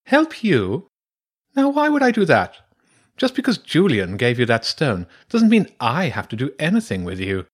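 high-pitched, indignant badger; haughty badger priestess. Badger priestess refusing to help two children, haughty and high. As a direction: refuse them in a high, affronted creature voice.

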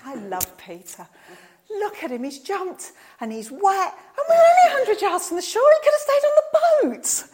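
starts in a whisper, builds to a high laughing shout; John laughs fondly at Peter leaping into the water. Retold or imitated. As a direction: chuckle at him fondly, then cry out. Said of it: "I could just hear John going".

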